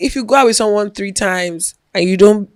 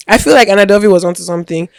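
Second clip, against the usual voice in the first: ordinary talk, nothing marked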